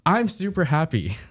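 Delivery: high-pitched, falling sarcastic cheer; sarcastically over the happy words. Acted. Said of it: "in the most sarcastic tone possible".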